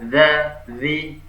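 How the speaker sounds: "za, zi" with a light, thin Arabic dh; thin dzal. Shown as a demonstration. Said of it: "Nah, tipis".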